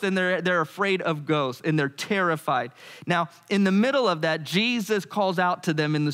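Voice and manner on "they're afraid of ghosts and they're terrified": ordinary talk, nothing marked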